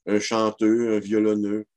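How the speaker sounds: "chanteux, violonneux" with -eux for -eur; silent final R. Shown as a demonstration. Lecturer continuing examples, "chanteux" without final r. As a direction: drop the r ending "chanteux", "violonneux". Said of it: "devient muet dans les non-verbaux".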